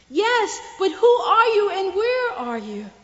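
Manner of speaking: high and loud; anxious calling. Acted. Man on the branch shouts up to the unseen voice, loud. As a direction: call up, desperate to know.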